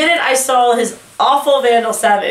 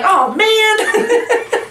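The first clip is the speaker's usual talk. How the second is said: imitating Nate; 11 semitones higher than usual. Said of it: "Even Nate was like".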